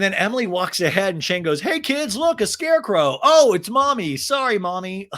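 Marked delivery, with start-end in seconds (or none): 1.6-5.1 high, loud teasing dad; mock calling out. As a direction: call the kids over with hammed-up surprise. Dad jokingly calling out to kids.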